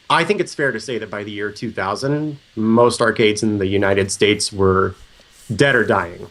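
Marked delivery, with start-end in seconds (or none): none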